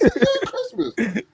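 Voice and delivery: high, drawn-out words through laughter; helpless laughing speech. Real, not acted. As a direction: crack up while repeating it. Cracks up repeating the quote.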